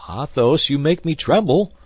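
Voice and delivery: loud, high-pitched cry of dread; alarmed cry. A narrator's reading. D'Artagnan dreading his diamond was staked, crying out. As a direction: cry out in alarm at your friend. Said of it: "cried D'Artagnan".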